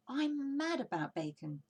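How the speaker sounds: eager, gleeful cry; excited cry. Acted. Badger crying out to Fox over the bacon, excited. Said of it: "cried Badger, dancing with excitement".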